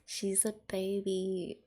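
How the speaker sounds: usual speaking voice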